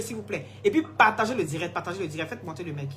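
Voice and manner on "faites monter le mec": usual speaking voice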